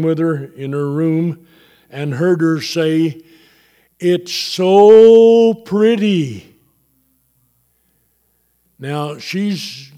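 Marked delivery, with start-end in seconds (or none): 4.0-6.6 long, loud, higher "so", drawn out slowly; slow, swelling wonder, quoting her; retold awed exclamation. Husband quoting his wife near death, slow awed exclamation.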